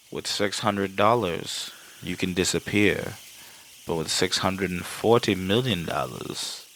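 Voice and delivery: low, slow villain monologue; deep, measured and softly menacing impression; Hans. Hans explaining the money to John, low deliberate villain voice. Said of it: "Hans sits there and tells him".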